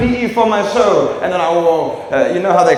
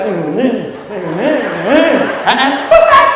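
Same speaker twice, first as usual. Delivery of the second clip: rising panic; 3 semitones higher, slower than usual. Pretend. Comic acting out panic in bad turbulence, building to a yelp. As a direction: build from nervous hums to a yelp. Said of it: "But when you're like".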